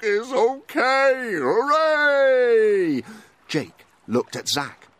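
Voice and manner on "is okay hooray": higher, louder, slow happy frog; joyful frog. A narrator's reading. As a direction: frog cheering at its croak. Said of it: "Froggle Frog said".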